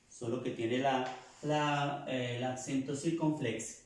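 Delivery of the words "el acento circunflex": ordinary talk, nothing marked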